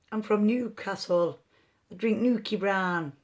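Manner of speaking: a wobbly attempt at a Newcastle accent that drifts; bad Geordie accent. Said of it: "I don't know if that's Brummie".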